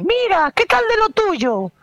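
very high-pitched call from a car window; calling stranger. Retold or imitated. Driver asks after his ailment from the car, high calling voice. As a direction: mimic a nosy driver calling over. Baja la ventanilla y me dice.

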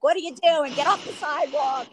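high-pitched mock yell at bike riders; imitating yourself hollering at riders; retold scream. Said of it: "and I'm screaming".

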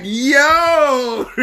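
high yell breaking into laughter; excited shout. Acted. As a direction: holler it, then laugh.

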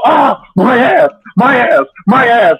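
high, slow pained shout; mock pained yell. Mimicking a player caught in his bear trap, hammed-up yells. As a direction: yelp like a victim stuck in a bear trap. Mimicking trapped game victim.

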